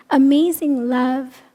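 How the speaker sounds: usual speaking voice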